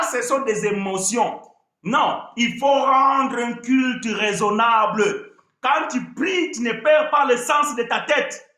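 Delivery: ordinary delivery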